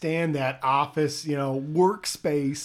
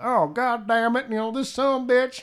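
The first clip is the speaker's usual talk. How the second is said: put-on Southern accent; 6 semitones higher than usual. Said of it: "he's doing a Southern accent".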